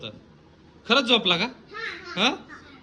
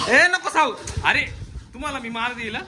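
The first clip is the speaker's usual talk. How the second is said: scolding call; 9 semitones higher than usual. Real, not acted. Scolding child splashing water. (मोठ्याने दटावणे)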